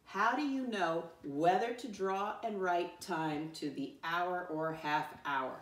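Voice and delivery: measured choral-reading pace; every word given room, deliberately; slow read-along. Shown as a demonstration. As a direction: read slowly so a class can join in. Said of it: "Read the essential question one more time with me".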